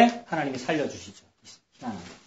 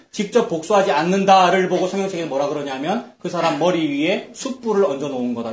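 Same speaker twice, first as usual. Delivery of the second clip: normal talking voice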